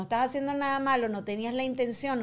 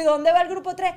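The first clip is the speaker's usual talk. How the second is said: raised question; 6 semitones higher, 8 dB louder than usual. Retold or imitated. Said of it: "Y yo le digo".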